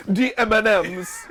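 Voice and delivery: high, slow mock-Jamaican; retry a terrible Jamaican accent; drawn-out fake Jamaican lilt; bad Jamaican accent. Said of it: "need to talk in a Jamaican accent".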